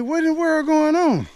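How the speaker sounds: put-on high, puzzled cry of a boy kept inside; asking in disbelief why he's stuck indoors; bewildered kid. Boy stuck in his room, baffled, in a high puzzled voice.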